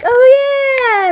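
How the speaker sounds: very high, loud, drawn-out cheer; hammed-up burst of joy; mock elation. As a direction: whoop it slowly, stretched out. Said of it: "they're all laughing, and I'm like".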